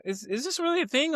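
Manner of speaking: high-pitched mock disbelief; incredulous disbelief. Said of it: "I'm like".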